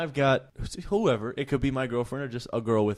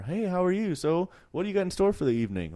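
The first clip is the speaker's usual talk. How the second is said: high mock wife; 8 semitones higher than usual. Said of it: "you can hear her".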